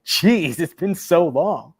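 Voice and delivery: exasperated on others' behalf; mock exasperation. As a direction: imitate fed-up guards, stretching the words. Guards retold as fed up.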